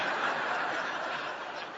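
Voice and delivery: quiet breathy laugh; a short soft chuckle. Real, not acted. Pastor laughing at his own refusal, soft chuckle.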